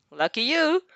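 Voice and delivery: plain reading voice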